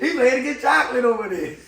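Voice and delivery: can't hold back the laugh; giggling punchline. Real, not acted.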